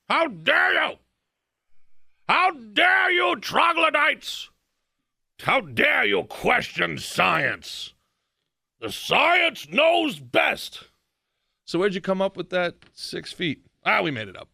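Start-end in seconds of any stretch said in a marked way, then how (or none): 0.1-10.8 high, slow, shouted mock outrage; mocking the science scolds. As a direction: ham up a shrill lecturing scold. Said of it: "they were yelling at you to trust the science".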